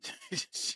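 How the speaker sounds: a breathy, uncontrolled burst of laughter; short laugh. Real, not acted. Co-host laughs at grim news despite himself. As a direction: laugh before catching yourself. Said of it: "I don't know why I'm laughing at that".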